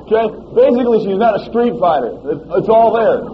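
loud, impassioned preaching; preaching at full force. Acted. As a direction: drive it home to the congregation.

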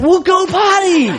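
high, slow exasperated order; exasperated dad. Retold or imitated. Dad ordering his son to go potty, exasperated. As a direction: act out a dad at wit's end.

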